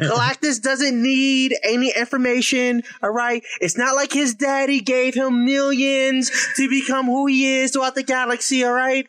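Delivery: lightly put-on stump-speech accent; mock campaign politician. Campaign manager sneering at a rival's rich daddy, in mock politician style. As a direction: defend the candidate like a politician.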